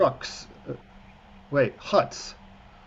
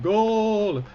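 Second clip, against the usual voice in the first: mock goal yell; 6 semitones higher, 3 dB louder than usual. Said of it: "they yell it once".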